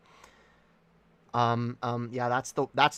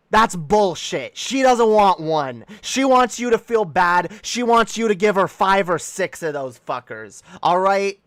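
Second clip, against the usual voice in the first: furious rant; 6 semitones higher, 7 dB louder than usual. Acted. Warning the boyfriend about the girlfriend, a loud furious rant. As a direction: rant like you've been robbed. Ranting about fry requests.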